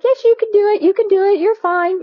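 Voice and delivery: upbeat little cheerleader voice in your head; cheering good angel. Retold or imitated. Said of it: "And the other one's like".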